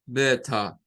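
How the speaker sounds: British "better" ending in schwa, no R; London "better", T clear, final R dropped; British accent. Shown as a demonstration. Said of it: "al final el schwa". (acento británico, better terminando en schwa sin R)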